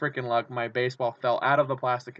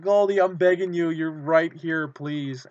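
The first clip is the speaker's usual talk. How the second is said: begging fan; 4 semitones higher, 4 dB louder, slower than usual. Retold or imitated. Last reach over the crowd.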